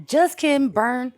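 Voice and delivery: high, loud mock taunt; taunting just kidding. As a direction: jeer it like a playground burn. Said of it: "just kidding, cops".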